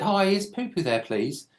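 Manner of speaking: high, sugary innocent ask; fake-polite kid voice, pitched high; cheeky prank voice. Boy prank-calling an old lady, high and sugary.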